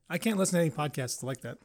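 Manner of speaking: ordinary delivery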